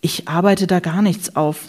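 usual speaking voice

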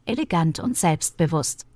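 ordinary talk, nothing marked

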